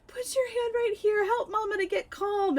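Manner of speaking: high, small retold plea; pleading mama voice. Panicking mother to her child, high-pitched plea. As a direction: ask a little child for comfort.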